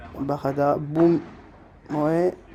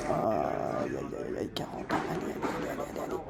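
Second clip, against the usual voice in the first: barely voiced, much softer, holding breath; tense murmur; 6 semitones lower, 13 dB quieter than usual. Real, not acted. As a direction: mutter it under your breath, nervous. Tense moment at forty, murmuring. (murmure tendu, presque chuchoté)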